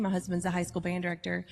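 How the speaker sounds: ordinary talk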